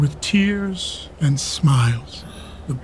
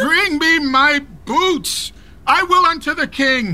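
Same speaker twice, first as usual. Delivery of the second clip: furious command; 10 semitones higher than usual. Acted. Father set on riding to the king, shouting for his boots. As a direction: plant each word with fury.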